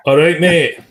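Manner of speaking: a hint of a Scottish accent, high and loud; jokey Scottish greeting. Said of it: "I didn't speak in a Scottish accent".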